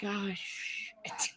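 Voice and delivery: soft, sinking mutter of dread; a husband realising he's trapped, muttered; caught out. Retold or imitated. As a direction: say it under your breath, caught red-handed. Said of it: "Zeus is like".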